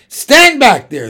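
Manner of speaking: loud, high bear keeper shout; gruff shout. A narrator's reading. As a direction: bark it at the crowd. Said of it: "they said".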